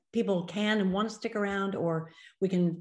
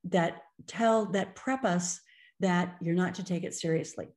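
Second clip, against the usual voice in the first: normal talking voice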